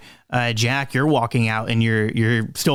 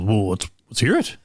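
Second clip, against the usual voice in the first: low waddling creature voice; lower character voice; 3 semitones lower than usual. Acted. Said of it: "waddles over to you and goes".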